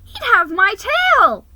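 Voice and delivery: high, loud fretful wail; fearful cry. Acted. Nervous mouse frets about his grandfather, high and loud. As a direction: squeal it in dread.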